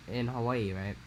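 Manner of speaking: ordinary delivery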